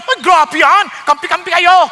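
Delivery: loud mock-indignant accusation; quick to anger. Imitating wives hearing about submission, loudly offended. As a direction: cry foul at the preacher. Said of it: "Nagagalit agad".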